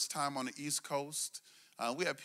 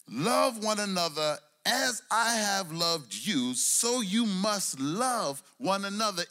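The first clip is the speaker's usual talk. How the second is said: slow, swelling preacher's cadence over music; preached scripture; 4 semitones higher, 5 dB louder, slower than usual. Acted. Preacher to congregation, slow swelling scripture. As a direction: lean into each word like a sermon's peak.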